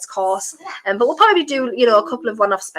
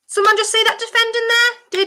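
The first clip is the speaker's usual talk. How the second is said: incredulous; 12 semitones higher than usual. Real, not acted. Reacting to awful defending.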